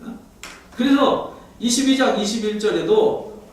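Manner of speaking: usual speaking voice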